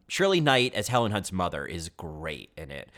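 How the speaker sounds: ordinary delivery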